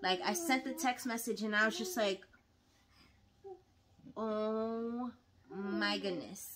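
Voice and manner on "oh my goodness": slow, drawn-out mock dread; slow horrified realisation. Wife realising she texted the seminar leader, slow mock dread. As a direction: stretch it out as the mistake sinks in.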